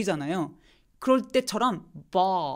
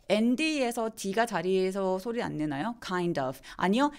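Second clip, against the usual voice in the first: ordinary delivery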